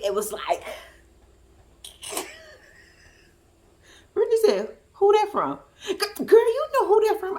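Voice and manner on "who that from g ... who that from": high-pitched sassy back-and-forth; imitating girlfriends, pitched well up; retold friend's reaction. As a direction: toss it out high, knowingly. Friend Brittany acted out in exchange.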